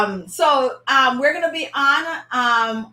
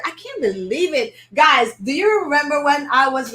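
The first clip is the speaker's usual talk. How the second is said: ordinary delivery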